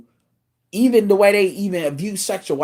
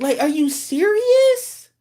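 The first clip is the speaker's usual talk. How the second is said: incredulous; 12 semitones higher, slower than usual. Real, not acted. Mocking woman's money logic.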